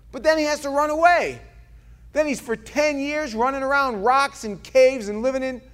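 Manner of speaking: high, loud, driving sermon voice; full-force preaching. Acted. Pastor driving David's story at his congregation, loud and high. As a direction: build the story like a rising altar call.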